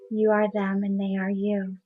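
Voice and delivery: usual speaking voice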